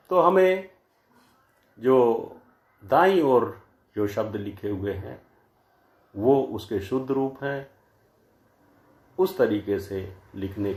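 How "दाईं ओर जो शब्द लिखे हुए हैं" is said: ordinary talk, nothing marked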